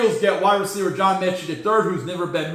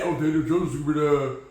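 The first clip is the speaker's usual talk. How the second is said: mock crowd voice; 4 semitones lower than usual. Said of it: "Everyone's saying".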